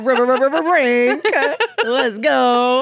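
loud, high hip-hop hype; mock hype performance. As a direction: shout it out like a pretend rap act. Pretend hip-hop band performance.

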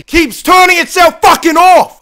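sudden loud, high angry shout; annoyed outburst. Real, not acted. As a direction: snap at the broken cam. Angry at face cam.

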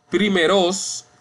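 primeros wrongly said prime-RÓS, pitched high; stress on last syllable. Shown as a demonstration. Teacher showing class a wrong form, primeros stressed prime-RÓS. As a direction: push the weight onto ROS in primeros. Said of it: "no decimos".

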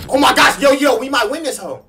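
the win is in reach, shout it; excited. Real, not acted. Repeated louder, higher.

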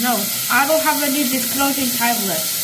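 speaking while brushing teeth; foamy wet mouth; mouth full of toothpaste foam. Real, not acted.

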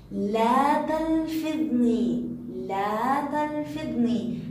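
very slow, drawn-out command; slow, emphatic teacher voicing a letter; sun lam speaking. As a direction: stretch each word as the letter giving orders. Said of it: "أنا لام شمسية".

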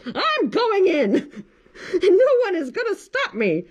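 high slow hen squawk; determined hen. Acted. Hen announcing she's going in, squawking. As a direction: declare it like a plucky hen. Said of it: "she announced".

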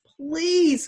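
high, loud anguished plea; crying out. Acted. Boy clinging to the dinghy cries out, high anguished plea. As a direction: cry the word out in desperation. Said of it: "Mahmoud cried".

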